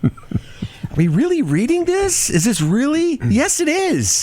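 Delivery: high, slow, disbelieving put-on voice; incredulous. Real, not acted. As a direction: read it back in disbelief. Disbelief at the news item.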